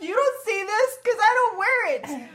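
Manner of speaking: high, loud re-enacted yelling; worked-up mock screaming retort; retold screaming. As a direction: shout it back at the saleswoman. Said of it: "So I start screaming at her".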